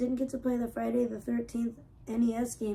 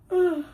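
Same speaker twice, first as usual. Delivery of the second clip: loud, higher groan of pain; 7 semitones higher, 14 dB louder than usual. Real, not acted. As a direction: groan from a sore stomach. Stomach pain.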